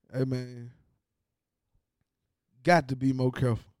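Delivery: ordinary talk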